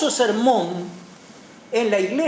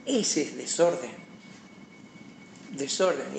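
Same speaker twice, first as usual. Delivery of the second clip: low, soft verdict; quiet gravity; 8 semitones lower, 8 dB quieter than usual. Real, not acted. Preacher passes judgement on disorder, quiet and grave. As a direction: drop pitch and volume, letting each statement sink in.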